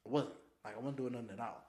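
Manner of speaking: ordinary talk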